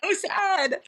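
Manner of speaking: high, loud wail of sorrow; mock lament. Real, not acted. Fan mourns the book's ending, high and loud.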